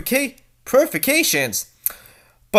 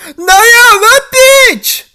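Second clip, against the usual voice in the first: very high, loud, tearful cry of betrayal; shrieking at being betrayed; outraged shriek; 11 dB louder, much slower than usual. Acted. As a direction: scream the traitor's name. Betrayal revealed.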